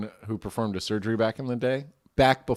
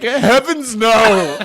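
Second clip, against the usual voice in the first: high, loud, drawn-out genteel wail; hammed-up olden-days cry of agony; old-timey scream; 9 semitones higher, 9 dB louder, much slower than usual. Shown as a demonstration. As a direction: scream it like a proper period victim. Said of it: "But back then, it's like".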